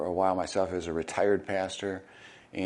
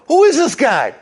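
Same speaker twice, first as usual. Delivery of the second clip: very high, loud incredulous squeal; squeaky astonished cry; baffled people; 14 dB louder than usual. Retold or imitated. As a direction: play the crowd's disbelief big. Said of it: "People go".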